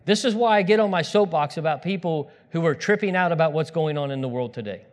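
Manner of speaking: ordinary talk, nothing marked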